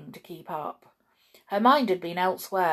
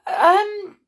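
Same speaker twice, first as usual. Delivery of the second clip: flustered mother; 7 semitones higher, 5 dB louder than usual. Acted. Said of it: "said Mother to Raj".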